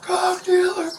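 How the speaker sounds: high-pitched mock scream, held back in volume; imitated scream. Man imitating his friend's outburst at the neighbor, mock screaming. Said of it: "screaming as loud as a person possibly could".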